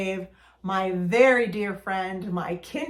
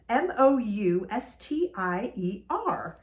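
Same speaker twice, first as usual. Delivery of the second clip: usual speaking voice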